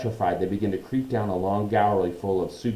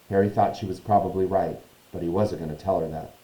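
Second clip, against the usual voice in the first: plain reading voice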